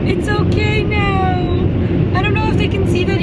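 calm him down gently after a laugh; laugh then soothing. Real, not acted. Laugh then slow comfort.

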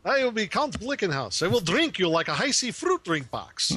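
hammy vampire count. Pretend. Joking as the vampire character, hammed-up count voice. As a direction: threaten like a cartoon count. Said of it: "sound like that dude that counts on Sesame Street".

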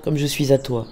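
ordinary delivery

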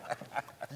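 soft unvoiced laugh, almost silent; breathy laugh. Community member laughing softly after cheering the boycott. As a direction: let out a quiet breathy chuckle.

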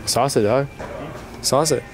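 ordinary talk, nothing marked

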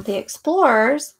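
ordinary talk

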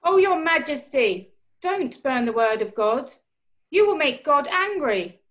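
pleading soldier. Acted. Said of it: "called out".

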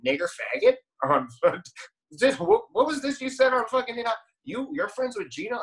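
high mock accusing interrogator voice; imagined casting director. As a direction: play a shocked casting agent grilling her, pitched high. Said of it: "they're gonna be like".

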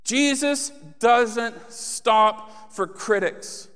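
usual speaking voice

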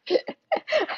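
a high, delighted laugh at her own joke; burst of high laughter. Real, not acted. Cracking up at her own pot joke, high giggle.

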